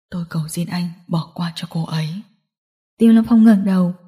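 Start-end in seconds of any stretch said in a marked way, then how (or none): 0.1-2.1 low, soft, defeated plea; hushed, humbled male character; pleading. Acted. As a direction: beg quietly for her life. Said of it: "lại có thể cầu xin bọn họ".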